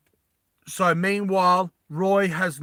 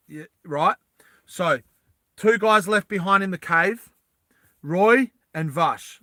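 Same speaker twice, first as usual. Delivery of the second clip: normal talking voice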